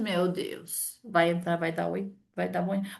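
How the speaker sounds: soft, low inner groan of dread; dreading mutter. Retold or imitated. The cold person inside the elevator, soft dreading mutter. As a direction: quietly dread the coming hello.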